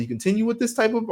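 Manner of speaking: high-pitched retold warning; retold ultimatum. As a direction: warn him, pitch jumping up. Retold ultimatum to rude customer.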